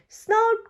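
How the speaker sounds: high, loud snort to surprise; loud piggy snort. Acted. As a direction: snort loudly to startle him. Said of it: "grunts Peppa loudly".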